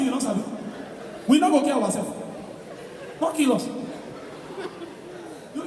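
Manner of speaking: normal talking voice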